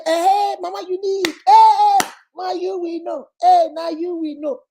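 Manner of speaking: high, loud cheering praise; flattering fans. Retold or imitated. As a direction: hype her up like an admiring follower. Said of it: "And then they will say".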